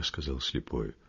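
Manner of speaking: ordinary delivery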